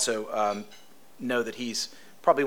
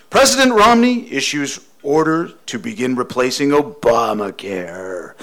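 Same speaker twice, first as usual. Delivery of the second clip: booming, slow political ad announcer; campaign ad voice; 9 dB louder than usual. Retold or imitated. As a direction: read it like a big-money TV spot. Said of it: "imitate the, the U S campaign ad voice".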